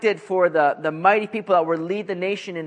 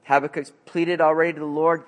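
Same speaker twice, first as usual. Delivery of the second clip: ordinary talk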